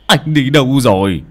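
put-on loud, fussing old father; worried scolding father. Father scolding his frozen son, loud and fretful. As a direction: scold the boy out of pure worry.

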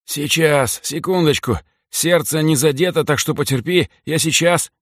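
put-on anxious muttering over a wounded girl; нервно. A narrator's reading. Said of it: "нервно приговаривал Митька". (нервно, торопливо)

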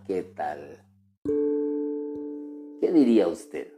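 ordinary talk

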